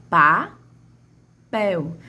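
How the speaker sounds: "Papel" split into "pa", long pause, "pel"; slow, separated beats on "Papel"; syllables separated. Shown as a demonstration. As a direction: say "Papel" one syllable at a time. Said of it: "Qual é a sílaba que mais se estende".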